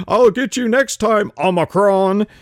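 high, loud cartoon baddie; "I'll get you next time, Omicron" shaken out like an eighties villain. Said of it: "cartoon villain".

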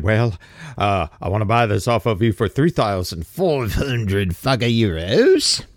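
low grumble as a reluctant buyer; mock grumbling. A rule follower haggling over a cash sale, grumbling. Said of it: "who's gonna grumble about it".

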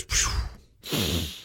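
soft, low whoosh of breath; relieved exhale. Acted. Speaker shows a mind finding quiet, soft exhale. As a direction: let the breath blow out quietly. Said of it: "when your mind just says".

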